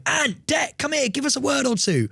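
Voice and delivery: high-pitched calling after celebrities; roving reporter. Pretend. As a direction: hail them for a quick quote. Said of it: "gonna be a roving reporter".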